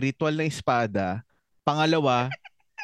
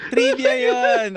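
booming slow game-show announcer, much higher and louder; slow it right down and announce it like a quiz host; mock announcer; 7 dB louder, slower than usual. Podcast co-host proclaiming a trivia fact to his partner, booming mock announcer.

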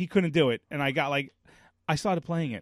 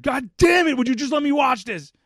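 exasperated dad yelling, higher and louder; 7 semitones higher, 8 dB louder than usual. Retold or imitated. Dad losing patience with his son, angry yell. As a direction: snap loudly at the kid, fed up. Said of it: "Daddy shouldn't have yelled".

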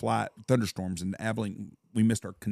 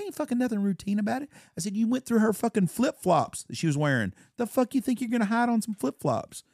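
high-pitched retold outrage; indignant retort; 10 semitones higher than usual. Husband berating airline agent over searched flip-flops, indignant. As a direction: argue back in a raised, incredulous pitch.

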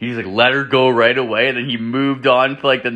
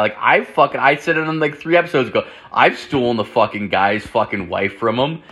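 usual speaking voice

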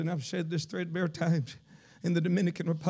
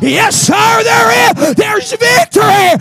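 rising revival preaching; 12 semitones higher, 18 dB louder than usual. Real, not acted. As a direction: build from firm to high, full-throated shouting. Preacher builds to full force.

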